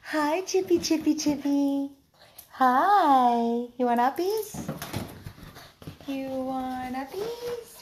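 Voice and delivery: high cooing pet voice; talking to a dog. Real, not acted. Baby talk to dogs.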